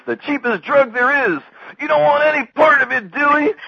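very high, loud exasperated mock shout; Sam's retort. Sam knocks down Dewey's fears, high insistent shout. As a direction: shout the rebuttal at the top of the range. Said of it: "and then Sam goes".

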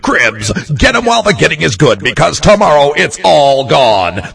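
hammed-up event promo announcer, loud and booming; radio announcer voice. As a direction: hype it like a big Sunday arena ad. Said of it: "Were you born with your radio voice?".

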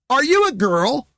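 high-pitched child imitation, not shouted; little girl asking loudly. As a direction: ask it bluntly like a small kid. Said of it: "but she loudly asks".

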